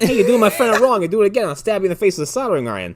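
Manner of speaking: a hint of Walken's voice in a threat; Christopher Walken. Podcaster retells a film character's threat, Walken impression. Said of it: "full Christopher Walken from fucking Joe Dirt".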